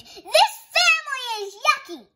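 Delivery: put-on high, loud childish scream. Betty screams at her family, loud high tantrum. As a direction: shriek it in a tantrum. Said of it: "Betty Bunny screamed".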